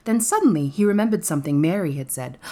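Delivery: ordinary delivery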